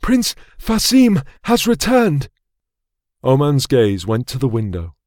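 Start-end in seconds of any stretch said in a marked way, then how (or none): 0.0-2.3 higher, slow, out of breath; breathless announcement. Acted. Servant announcing the prince's return, breathless. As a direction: gasp out the news after running. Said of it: "catching his breath".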